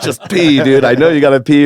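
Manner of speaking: high, loud coaxing; urgent mock plea; exasperated pleading. Pleading with dog to pee.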